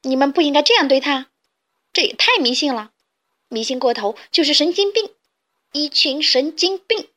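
loud, rushing then slowing scolding; indignant loud shout. Acted. Carmen shields the kitten and shouts at the chicks, indignant. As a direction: defend the cat furiously. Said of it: "卡门护着小黑猫大声喊".

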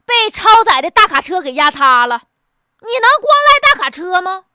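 ordinary delivery

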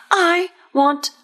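plain reading voice

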